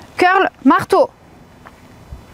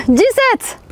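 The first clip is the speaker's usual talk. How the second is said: laughing; 6 semitones higher than usual. Real, not acted. Laughs after miscounting.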